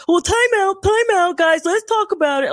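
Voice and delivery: a purposely bad British impression of Steven Grant; bad British accent. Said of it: "that was supposed to be a British accent".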